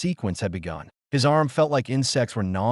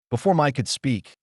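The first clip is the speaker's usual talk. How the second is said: ordinary delivery, nothing marked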